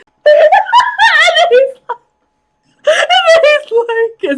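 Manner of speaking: high shrieking laughter through the words; can barely get the words out for laughing; laughing hard. Real, not acted. Viewer retelling a show scene, laughing helplessly.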